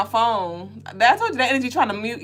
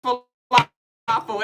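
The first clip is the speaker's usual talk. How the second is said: mom scolding; 6 semitones higher, 3 dB quieter than usual. Retold or imitated. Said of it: "She was like".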